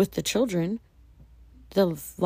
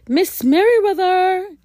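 loud, high call down the hall; 14 semitones higher, 11 dB louder than usual. Acted. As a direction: shout her name from afar. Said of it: "he called as he walked".

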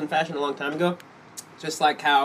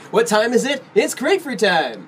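hammed-up ad slogan; 8 semitones higher, 5 dB louder than usual. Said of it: "it's almost like".

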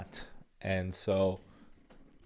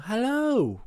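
put-on English accent. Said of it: "In my head, that sounds".

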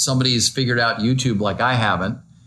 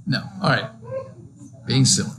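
ordinary talk

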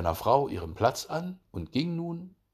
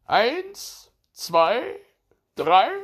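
high, loud, spaced count; high hare voice, each number called out; 12 semitones higher, 8 dB louder, much slower than usual. A narrator's reading. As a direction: count off like a race starter. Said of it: "Der Hase zählte".

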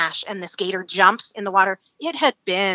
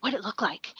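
quiet question; 2 semitones higher, 10 dB quieter than usual. Retold or imitated. Guide asks quietly while hiding.

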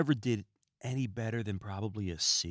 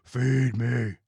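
louder, hammed-up hungry plant monster; man-eating plant voice; 7 dB louder than usual. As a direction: demand food like a carnivorous movie plant. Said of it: "a plant that wants to eat people".

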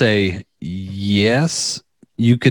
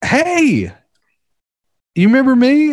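high, louder imitation of a woman; imitated girl; 4 dB louder than usual. Job applicant recognises the interviewer from a bar, high mimicked girl voice. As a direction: pitch it way up as the girl greeting him. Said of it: "And she goes".

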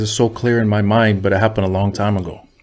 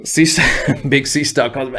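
ordinary talk

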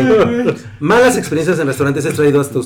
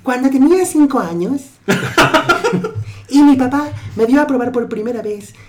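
put-on little kid voice; 6 semitones higher, 3 dB quieter than usual. High voice telling childhood.

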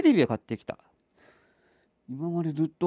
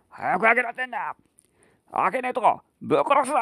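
put-on rough drunken threat, higher; drunk threatening father; 6 semitones higher, faster than usual. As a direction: snarl a drunk death threat.

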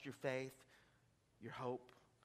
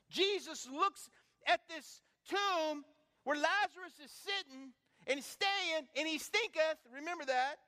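high, loud revival preaching; full-force pulpit build; fiery preaching; 13 semitones higher, 8 dB louder than usual. Acted. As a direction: preach it to the rafters.

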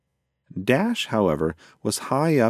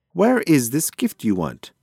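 raised voice, pitched higher; mild yell; 6 semitones higher, 4 dB louder than usual. A narrator's reading. Pigeon in flight asks his passenger, mildly yelling. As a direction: call it out impatiently, not at full shout. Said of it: "yelled Flicker".

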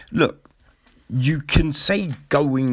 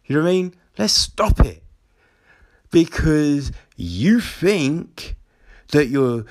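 ordinary delivery

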